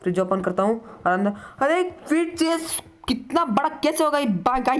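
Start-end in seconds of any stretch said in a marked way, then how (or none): 1.6-4.8 high frightened cry; panicked. Real, not acted. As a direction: voice shooting up in fright. Panicking during horror game.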